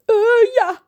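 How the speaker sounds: very high, loud embarrassed agreement; sheepish. Acted. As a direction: caught out, mumble yes. John betrapt. (schuldbewust eh ja)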